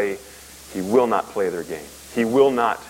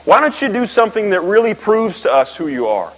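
higher, louder taunting demand; mocking challenge; 10 semitones higher, 5 dB louder than usual. Preacher voicing Pharisees taunting Jesus, higher and louder mockery. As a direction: needle him like a smug heckler. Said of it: "have the guts and the nerve to walk up to him and say".

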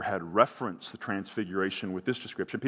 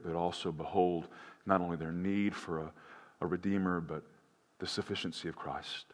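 hushed, reverent pastoral prayer; 2 semitones lower, 3 dB quieter than usual. Real, not acted. Pastor praying over his church, soft and reverent. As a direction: pray softly over the congregation. Closing prayer before sermon.

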